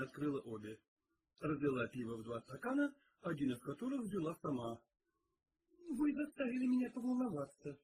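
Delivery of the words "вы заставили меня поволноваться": high-pitched mild reproach; reproachful. A narrator's reading. Cook to detective after the scare, reproachful. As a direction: chide him in a raised pitch. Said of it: "с упрёком сказала кухарка".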